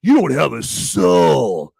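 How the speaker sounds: slow, preachy mock outrage; mock dramatic "you don't have a soul"; mocking online commenters. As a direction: sneer it like a self-righteous commenter. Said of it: "people ... say".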